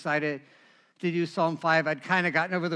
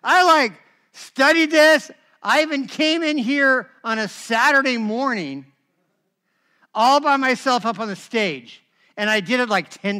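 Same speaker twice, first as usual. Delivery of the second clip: louder, indignant retelling; indignant, louder, still smarting; worked up; 4 semitones higher, 7 dB louder than usual. Real, not acted. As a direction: pour out the frustration, loud.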